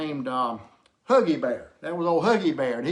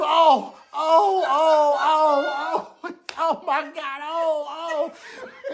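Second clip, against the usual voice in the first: very high, loud hammed-up oh of pain; mock pained cries; 10 semitones higher, 6 dB louder, much slower than usual. Drunk man cries out as the leprechaun gets him. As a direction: wail it out, laughing through it. Prolonged wailing.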